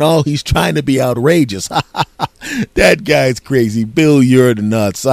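hammed-up guffawing between phrases; mock group laughter. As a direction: play guys cracking up at an outrageous buddy. Guys laugh off the crude remark.

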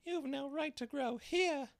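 very high, squeaky little voice; dormouse voice. A narrator's reading. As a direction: scold like a tiny mouse. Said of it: "said the Dormouse".

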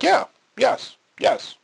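a sudden high burst of agreement; emphatic yes. Retold or imitated. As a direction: jump way up on the yes. Emphatic agreement in retold talk.

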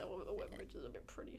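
unvoiced whisper of a passing thought; whispered musing. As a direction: breathe it out as a private aside. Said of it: "But I was like".

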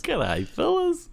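very high, drawn-out Aussie greeting; squeaky-high mock Aussie drawl; exaggerated Aussie. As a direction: stretch it out in a hammed-up Australian accent. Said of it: "still sounded really Aussie".

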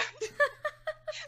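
real giggle; high laugh. Real, not acted. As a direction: giggle at how absurd it was. Laughs at the retold call.

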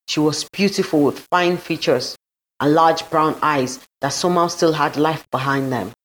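usual speaking voice

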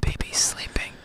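hushed whisper. Acted. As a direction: whisper so the baby sleeps. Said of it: "so we're just gonna whisper".

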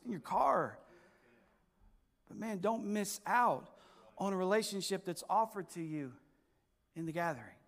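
low, soft and intimate; soft reflective preaching. Acted. Pastor confiding softly to his congregation, hushed. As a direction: draw them in close and quiet.

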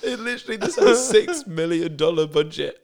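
real laughing fit, very high-pitched squeaky words; helpless laughter. Real, not acted. Cracking up at a terrible movie script, helpless high laughter. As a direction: squeeze the words out through uncontrollable laughter. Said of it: "why this is so funny".

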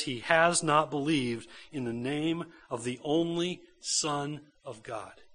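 slow, swelling, emphatic reading of the verse; scripture reading. Acted. Reads scripture with force.

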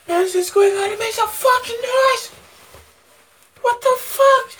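arena hype scream; spazzing out. Pretend. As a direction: scream it like an arena announcer gone wild. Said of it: "Let me just spaz out".

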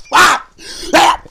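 high, loud comic cries of alarm; mock yelp. Reenacting the dog attack for his co-host, loud high mock yelps. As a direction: imitate yelping while a dog shakes you. Reenacts yelling while being bitten.

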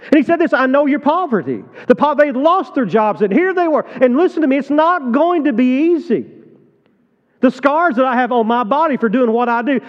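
high, loud sermon push; preaching at full force. Real, not acted. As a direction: drive it home to the pews. Preaching on Smyrna's suffering.

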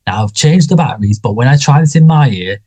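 a hint of fussy, eager Colin; Colin impression. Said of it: "Colin says".

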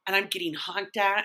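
ordinary delivery

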